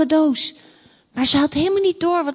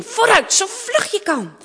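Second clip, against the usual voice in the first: urgent; 7 semitones higher than usual. Acted. King urges daughter to flee.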